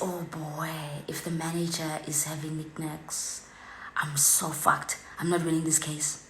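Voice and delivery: soft whispered dread to herself; hushed, resigned whisper to yourself; whispered aside. Retold or imitated. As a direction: whisper it like you're doomed.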